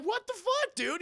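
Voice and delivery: very high-pitched disbelief; shocked outburst. Retold or imitated. Lucius seeing Bruce crawl in, shocked outburst. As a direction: squeak out your alarm at the intruder.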